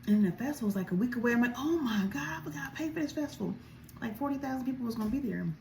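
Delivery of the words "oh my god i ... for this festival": sudden panic. Retold or imitated.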